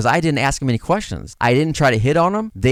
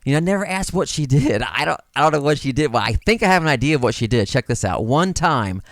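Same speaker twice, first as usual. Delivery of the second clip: ordinary talk, nothing marked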